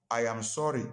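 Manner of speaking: I AM SORRY said evenly, every word given; deliberate, complete I AM SORRY, pitch settled; full apology. Shown as a demonstration. Preacher shows the congregation a fuller apology, sincere. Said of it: "I am sorry" is not the same".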